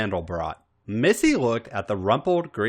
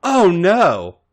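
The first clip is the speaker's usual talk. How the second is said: alarmed protest; 9 semitones higher, 9 dB louder than usual. Acted. Robin protests.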